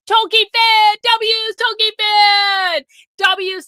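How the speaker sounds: loud, high cheer with the last name drawn out; excited shout. Real, not acted. Streamer celebrates a viewer's gifted memberships, excited high shout. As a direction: holler it like a hype announcer.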